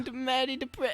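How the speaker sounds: high mock Jackie Kennedy accent. Mocking Natalie Portman's role, a high Jackie Kennedy accent. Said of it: "Natalie Portman really nailed that obscure accent".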